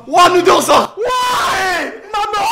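high, loud victory yell; excited shout. Real, not acted. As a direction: cheer like you just won. Shouting on winning the round.

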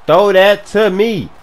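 loud, high, drawn-out demand; emphatic shout. Real, not acted. Open receiver demanding the pass, emphatic shout. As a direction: shout it slowly so he listens.

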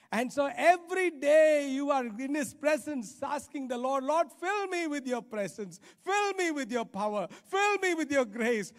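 high, loud, pleading prayer; impassioned preaching plea; fervent preaching prayer. Acted. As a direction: cry out to the Lord to fill you.

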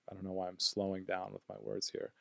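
usual speaking voice

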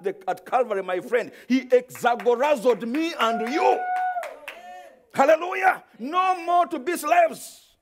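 high, loud, slow preaching crescendo; preacher roaring about freedom to the congregation; preaching at full force. Acted. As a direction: build the sermon to a shouted peak. Preaching climax.

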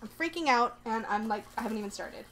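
ordinary talk

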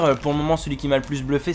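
normal talking voice

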